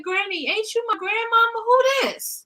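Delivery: high, incredulous "who this"; mock baffled call. On the phone to granny, high mock disbelief. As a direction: pitch way up in fake disbelief. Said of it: "I said".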